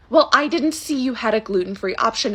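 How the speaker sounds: ordinary delivery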